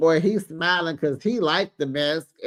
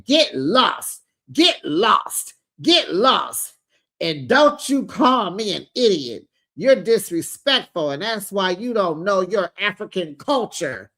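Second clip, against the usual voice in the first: raised, punchy mock outburst; angry dismissal; 2 semitones higher, 3 dB louder than usual. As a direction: act a furious mother ordering someone off. Acted mother's angry quarrel.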